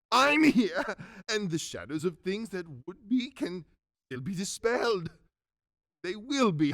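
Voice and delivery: swooping pitch, bursting into laughter; giddy, overjoyed Scrooge. Acted. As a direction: relief bubbling over into giddy glee.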